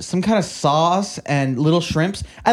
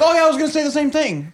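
high, laughing mock of a friend's excuse; mocking quote; 10 semitones higher, faster than usual. Mocking a friend who claims he'd say the same, high and laughing. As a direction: parrot him in a silly high voice while laughing. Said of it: "You're like".

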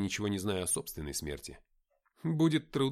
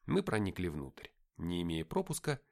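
ordinary delivery

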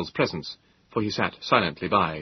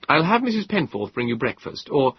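higher, lighter voice for the younger inventor; a touch of wry modesty; young Moses; 6 semitones higher, 3 dB louder than usual. Acted.

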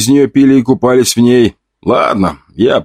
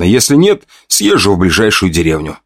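usual speaking voice

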